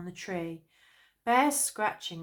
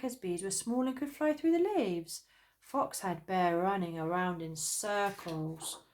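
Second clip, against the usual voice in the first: ordinary delivery, nothing marked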